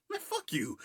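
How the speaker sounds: very high squeaky comeback; mock indignant retort. Friends answering Anton's scolding, squeaky mock indignation. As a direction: throw it back in a hammed-up falsetto.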